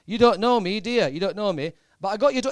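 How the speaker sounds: a teasing pop star on the phone. Retold or imitated. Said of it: "that's how he speaks".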